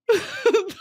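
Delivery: high-pitched burst of giggles; laughing. Real, not acted. Streamer cracking up at own Hunger Games bit, laughing.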